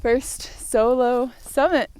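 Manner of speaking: proud, loud, slow declaration; excited. Real, not acted. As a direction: announce it with real pride. Celebrating at the summit.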